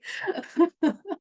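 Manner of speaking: real laugh climbing high; high rising laugh. Real, not acted. Laughing at her own remark, a real rising laugh. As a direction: let a bright laugh go up.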